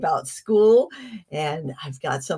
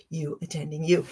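usual speaking voice

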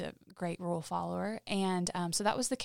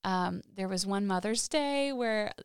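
ordinary talk, nothing marked

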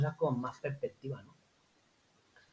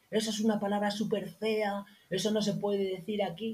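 put-on scolding kids; 7 semitones higher than usual.

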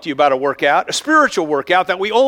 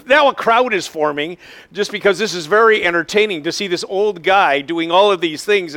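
ordinary talk

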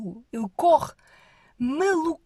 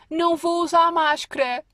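louder, higher stubborn whine; mocking the anti-maskers; 8 semitones higher, 8 dB louder than usual. Mimicking anti-mask posters refusing masks, loud mocking whine. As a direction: pout it like a spoilt child. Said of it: "a dizer".

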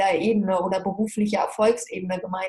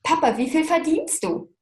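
higher, indignant echo of the question; incredulous repeat; 8 semitones higher than usual. Retold or imitated. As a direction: throw the question back in disbelief. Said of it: "Und der Papa schon so".

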